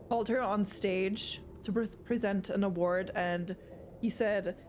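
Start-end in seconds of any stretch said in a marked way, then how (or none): none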